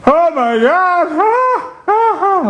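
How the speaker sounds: slow, high wails of pain; high pained cries. Retold or imitated. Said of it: "walking down the hall screaming".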